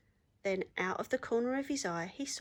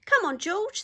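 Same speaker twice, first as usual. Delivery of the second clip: much higher, louder excited urging; eager animals; 11 semitones higher, 9 dB louder than usual. Acted. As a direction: urge George on eagerly. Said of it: "they said".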